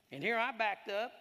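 loud, high preaching at full force; fired-up preaching. Acted. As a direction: preach it like a revival.

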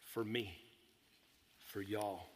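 low, soft and heartfelt; quiet, grave sincerity; solemn, hushed. Real, not acted. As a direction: let each phrase drop reverently, with long pauses. Solemn reflection on Christ's suffering.

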